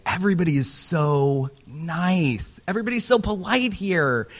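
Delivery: high, sugary-sweet mock politeness; Midwest nice voice. Imitating polite Midwesterners to the audience, high and sweet. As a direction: put on the syrupy Midwestern nice. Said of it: "a lot of people will say".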